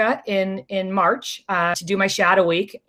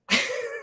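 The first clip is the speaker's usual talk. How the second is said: laugh; 9 dB quieter than usual. Real, not acted. Laughing at dad's text.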